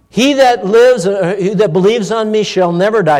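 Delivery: high, loud scripture proclaimed from the pulpit; a preacher crying out a Bible promise; preaching. Acted. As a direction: ring out the verse with full conviction. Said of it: "That's what the Bible says".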